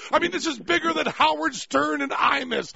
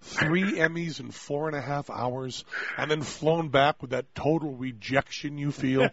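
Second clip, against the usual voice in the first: low, hushed confession; hammed-up solemn heartbreak, pitched low; mock devastation; 9 semitones lower, 4 dB quieter than usual. Mock-solemn confession of award loss.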